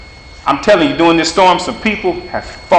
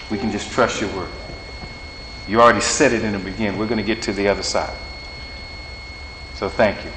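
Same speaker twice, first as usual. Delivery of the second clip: softer, lower prayer voice; hushed, devoted pastoral prayer; closing prayer; 6 semitones lower, 3 dB quieter than usual. Acted. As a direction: pray tenderly over the congregation.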